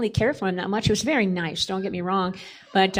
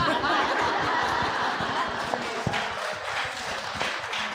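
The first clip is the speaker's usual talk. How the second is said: airy, breathy real laugh after the punchline; 5 semitones higher, 5 dB quieter than usual. Real, not acted. Wife laughing at her own courtship story, airy real laugh.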